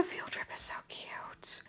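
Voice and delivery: soft high whisper, adoring. Real, not acted. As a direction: whisper it like a secret delight. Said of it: "whispering over the figures".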